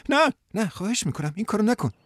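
Diane voiced high, begging him back; plead, stepping away from his embrace; pleading woman's voice. Diane begs Danny not to hold her, high and pleading.

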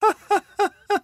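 high teasing giggle; high drawn-out laugh. Acted. Martha laughing at Frans, high and drawn out. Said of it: "خندید".

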